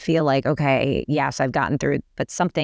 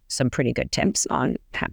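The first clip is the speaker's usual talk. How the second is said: ordinary delivery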